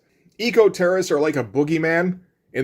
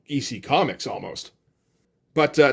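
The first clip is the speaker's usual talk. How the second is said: ordinary delivery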